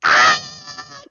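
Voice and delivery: high, loud shriek of delight; a real fan squeal; excited shriek. Real, not acted. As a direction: scream with joy at the drop. Hype moment in song.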